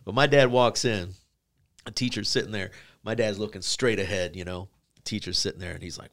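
normal talking voice

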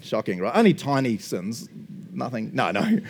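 ordinary delivery

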